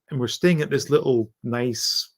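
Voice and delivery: ordinary delivery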